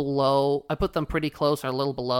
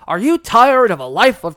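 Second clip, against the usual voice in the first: pirate voice; 7 semitones higher, 11 dB louder than usual. Retold or imitated. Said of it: "let me get my pirate voice in".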